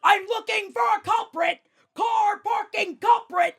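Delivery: high-pitched furious re-enacted yell. Storming into the studio hunting the culprit, yelling. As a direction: holler the accusation at full pitch. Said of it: "I started yelling".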